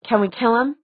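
a touch abrupt. A narrator's reading. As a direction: put the question abruptly, rising at the end. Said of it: "she asked abruptly".

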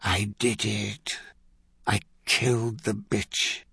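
low-energy, slow and softened; quiet, drawn-out final thought; dying last words. A narrator's reading. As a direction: say it slowly as life fades. Blackness comes for dying man.

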